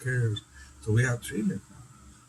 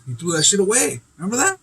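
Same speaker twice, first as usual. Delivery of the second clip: excited, rising high; 8 semitones higher, 5 dB louder, faster than usual. Real, not acted. Recalling the sandal fight, rising in high excitement. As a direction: voice climbs high and loud with excitement.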